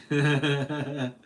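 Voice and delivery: hearty run of chuckles; quick, rippling self-amused laugh; real laugh. Real, not acted. Streamer admitting he was slow to catch on, hearty chuckle.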